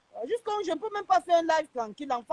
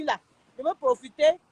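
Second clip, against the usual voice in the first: ordinary talk, nothing marked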